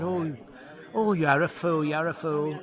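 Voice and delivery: lightly voiced lady in the audience; imitated tickled lady. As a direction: play a woman teasing the comic. Retold lady heckling the comic.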